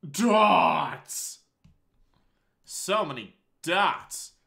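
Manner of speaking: loud, high cry, then slow; shocked cry. Real, not acted. Seeing the screen fill with dots, a loud, high shocked cry.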